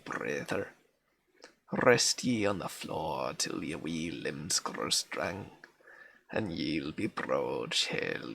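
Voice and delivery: reading a Scots lullaby poem in a broad Scots accent. Shown as a demonstration. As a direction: roll the Scots words gently, like a granny's old song. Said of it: "forces you to read in an accent".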